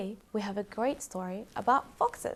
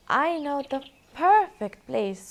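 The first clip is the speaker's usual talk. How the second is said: squeaking mouse; 6 semitones higher, 7 dB louder than usual. Acted. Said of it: "squeaked the mouse".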